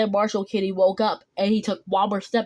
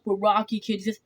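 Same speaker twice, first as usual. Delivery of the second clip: ordinary delivery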